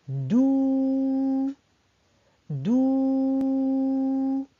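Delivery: DOU with a very long held "ou"; drawn-out vowel. Shown as a demonstration. Teacher dictating the first syllable to children, "dou" drawn out long. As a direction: stretch the vowel of "dou" on a level pitch. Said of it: "quelle lettre qui tire pour faire le son ou".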